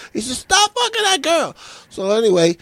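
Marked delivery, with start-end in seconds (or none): 0.4-1.5 very high-pitched strained call; acted yelling. Man up a tree scolding his friend, high acted yelling. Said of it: "he starts yelling out".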